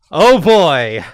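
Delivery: ordinary delivery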